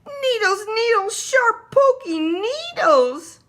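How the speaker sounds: very high, loud yell, slowing at the end. A narrator's reading. Little brother yells to Mummy about the porcupine, very high loud yell. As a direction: cry out like a kid who got poked. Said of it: "yelled Tate".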